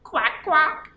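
bright quacking duck noise. Acted. Teacher voicing the duck for the kids, playful quacking. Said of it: "And it says".

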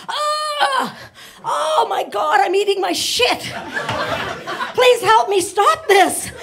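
high, loud desperate pleading; panicked monkey. Retold or imitated. Monkey praying in panic, high loud pleading. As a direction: beg frantically, voice high and loud. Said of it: "he says something like".

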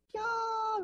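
very high delighted squeal. Pretend. Teacher mimics a client squealing at the room, high squeal. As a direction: let out a thrilled squeal. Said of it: "みたいな".

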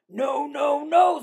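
the little wolves shouting back at the pig; loud refusal. A narrator's reading.

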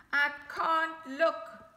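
slow, loud, high fearful cry; too scared to look, drag it out. Acted. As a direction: Bob too scared to watch. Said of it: "Bob said".

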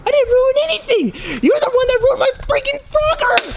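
high-pitched, indignant counter-accusation; outraged denial. Real, not acted. Denies ruining anything and blames the other, outraged. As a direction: hurl the blame back, voice way up.

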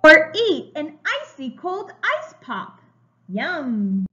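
slow, bright storybook reading; reading to children. A narrator's reading. Teacher reading a picture book to young children, slow and bright. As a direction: read it like a picture book for little kids.

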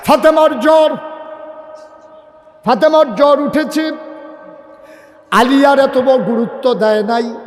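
high, loud, chanted cry; dramatic sermon storytelling at full force; impassioned preaching. Real, not acted. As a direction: cry out the news to a crowd.

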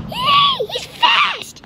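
very high, startled shriek; alarmed yell. Real, not acted. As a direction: cry out in shock at the speed. Startled by fast boss.

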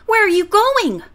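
put-on high, loud child's demand; a small boy insisting on an answer; demanding little boy. A narrator's reading. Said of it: "Freddie demanded".

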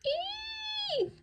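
shrill, very high squeal of fright; high eek scream. A narrator's reading. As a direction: shriek it at the top of the range. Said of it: "screamed P J".

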